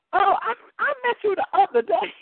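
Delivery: high-pitched mock greeting from a stranger; excited greeting. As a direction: exclaim it high, as if recognising someone. Imagined stranger greets her, excited.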